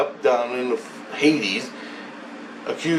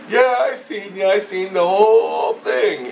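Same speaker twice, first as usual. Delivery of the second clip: taunting head voice; 8 semitones higher than usual. Retold or imitated. Voice in his head catching him out, taunting. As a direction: act out a needling voice, pitched way up. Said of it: "a voice in the back of my head goes".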